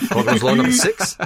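put-on Aussie announcement; Australian accent. Co-host announces a drinking-game rule, mock Australian accent. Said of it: "in the Australian accent?".

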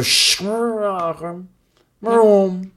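drawn-out imitation engine vroom; race car noise. Shown as a demonstration. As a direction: mimic a race car roaring past. Imitates NASCAR transition noise.

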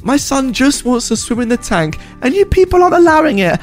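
high, furious entitled rant; angry outburst. Acted. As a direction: erupt like a volcano, shrieking. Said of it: "Karen went out of control".

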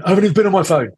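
mock child protest, high and loud; indignant child's protest. Child denying it to a parent, indignant mock protest.

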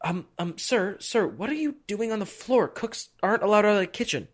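high, soft, hesitant servant voice; flustered servant. Acted. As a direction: play a servant quietly correcting someone. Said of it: "the servants are like".

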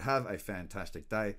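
normal talking voice

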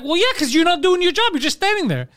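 high-pitched mock scolding; retold exasperated yelling. Scolding a friend fired for standing around, exasperated. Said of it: "Last time I'm yelling".

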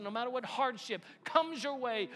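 climbing loud and high; building preaching. Acted. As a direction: swell to a booming climax. Building to climax.

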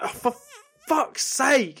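exasperated, high-pitched mock outburst; high, exasperated cursing; losing your rag. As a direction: act out losing it after a knock. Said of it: "you just lose your rag".

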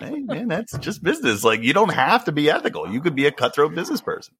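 jokey falsetto, justifying himself. Acted. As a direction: keep a light, high mock pop star voice. Still voicing [name] in falsetto.

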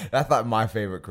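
real laugh spilling into the words; talking through a real laugh. Real, not acted. Laughs at his own joke.

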